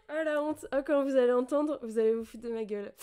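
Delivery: flustered, self-mocking embarrassment; embarrassed. Real, not acted. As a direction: exclaim in shame, expecting to be laughed at.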